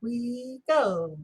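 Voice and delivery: drawn-out words on the beat; count-in. Shown as a demonstration. Teacher cueing the class to clap, drawn-out count-in. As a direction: stretch each word as a lead-in. Counting in before clapping along.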